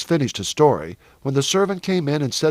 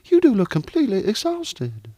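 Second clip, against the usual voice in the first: higher-pitched, mildly worried mother; anxious; 8 semitones higher than usual. A narrator's reading. Mother worries over her tired son, anxious. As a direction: fuss over him with worry in the voice. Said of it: "his mother said anxiously".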